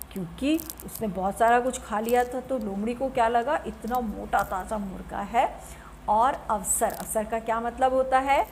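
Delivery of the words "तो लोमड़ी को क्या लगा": ordinary talk, nothing marked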